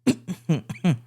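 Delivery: coughing after swallowing wrong way; sputtering cough on water; choking cough. Real, not acted. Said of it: "I just started choking on my water".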